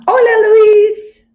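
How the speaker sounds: high, loud, slow drawn-out greeting; excited shout. Real, not acted. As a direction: shout the hello with delight.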